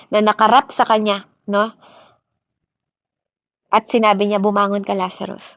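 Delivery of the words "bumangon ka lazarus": normal talking voice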